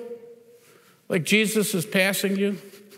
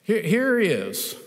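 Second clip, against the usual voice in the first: normal talking voice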